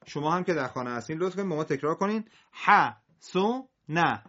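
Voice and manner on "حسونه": حسونه split slowly into parts; very slow separated reading; slow syllable by syllable. Shown as a demonstration. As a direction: read حسونه apart, slowly.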